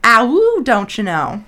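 loud howl into hammed-up Midwestern accent; Midwestern wolf accent. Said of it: "That's one of them Midwesterner wolves".

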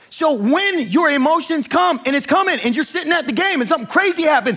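high, driving preacher's exhortation; impassioned preaching. Real, not acted. Pastor urging congregation to be emotionally ready, impassioned preaching. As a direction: preach it urgently, pitched well up.

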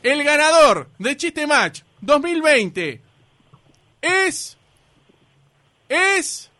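drumroll-style contest reveal; grand announcement. Real, not acted. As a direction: hold back the winner with long pauses. Host announces the winner.

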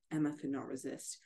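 usual speaking voice

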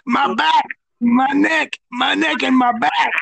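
high-pitched hammed-up moan; mock aching complaint. Co-host playing along with the hurt back, mock aching complaint.

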